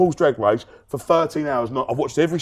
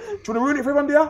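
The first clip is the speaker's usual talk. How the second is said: higher, prim scolding imitation of Tanya; lightly mocking carer voice; 11 semitones higher, much faster than usual. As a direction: play the stern minder, pitched up. Tanya voiced high in retold story.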